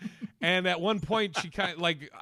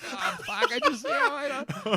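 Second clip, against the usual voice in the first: much higher, caught off guard and laughing; a startled, stammering backpedal; mock panic, voice jumping up; flustered surprise; 10 semitones higher than usual. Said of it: "I'm like".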